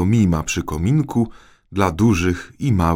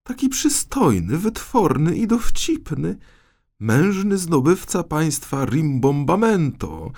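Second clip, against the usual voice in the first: put-on high girlish voice swooning over a prince; gushing princess; 12 semitones higher than usual. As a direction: rave about him like a smitten princess. Princess gushes about Bulbo. (zachwycona księżniczka, wysokim głosem)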